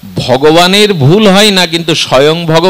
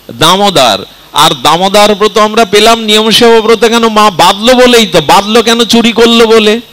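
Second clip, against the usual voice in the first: raised, higher-pitched preaching voice; impassioned preaching; 6 semitones higher, 3 dB louder than usual. Real, not acted. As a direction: drive the argument home to the congregation, high and insistent. Preacher drives the argument home. (আবেগভরা উঁচু গলায় ধর্মোপদেশ)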